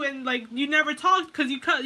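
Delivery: ordinary talk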